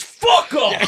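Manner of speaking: high, loud mock-furious yell; sudden high-pitched comic shout of rage; angry outburst. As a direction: imitate a granddad exploding at his family. Retold granddad swearing at waving family.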